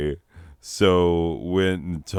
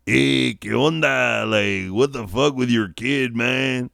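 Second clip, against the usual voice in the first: put-on high, loose Mexican-accented street voice; Mexican-accented dude; 5 semitones higher than usual. Part of the confused guy greeting in a Mexican accent. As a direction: Chicano homie asking what's up. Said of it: "Part of him was like".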